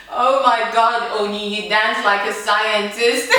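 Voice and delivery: loud, slow exclamation; mock horrified amazement. Dance teacher seeing her dance, mock appalled. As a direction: draw out each word in comic disbelief.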